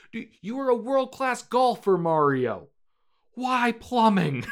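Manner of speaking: loud, high incredulous outburst; exasperated yelling dad. Acted. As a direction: yell in disbelief at your son. Acted father yelling at his son.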